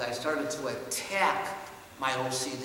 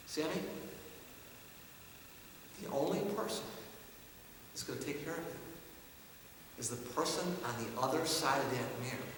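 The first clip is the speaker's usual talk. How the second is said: dad's quiet lesson; 4 semitones lower, 13 dB quieter than usual. Retold or imitated. Father softly telling his son the hard truth. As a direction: lean in and let it land gently.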